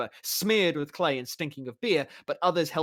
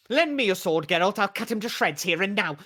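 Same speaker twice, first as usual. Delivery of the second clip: louder, furious and higher; enraged demand; 6 semitones higher, 5 dB louder than usual. Acted. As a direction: demand the sword to cut him down. Demands sword to kill doppler.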